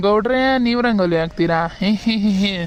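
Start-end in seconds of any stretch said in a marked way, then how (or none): 1.8-2.5 mocking giggle. Laughing at husband's rangoli.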